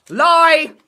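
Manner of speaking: high, loud yell of "Lie!"; playful accusing shout. Retold or imitated. Accusing his mate of denying the cheese, mock yell. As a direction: shout him down, mock-outraged.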